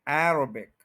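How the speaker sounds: अरेबिक as "AIR-uh-bik", AIR stressed; stress on first syllable. Shown as a demonstration. Teacher giving correct Arabic, stressed on AIR. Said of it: "first पे stress है".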